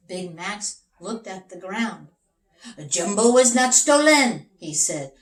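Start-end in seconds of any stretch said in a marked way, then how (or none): 2.9-4.5 firm announcement. Acted. Detective declares in story.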